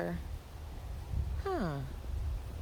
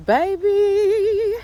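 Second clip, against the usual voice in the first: exasperated exclamation; 12 semitones higher, 8 dB louder than usual. Real, not acted. Rattled vlogger exclaiming to viewers, high and loud. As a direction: stretch it out in disbelief. Exclaiming after coyote scare.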